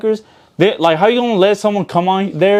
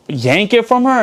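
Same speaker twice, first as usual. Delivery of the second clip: ordinary talk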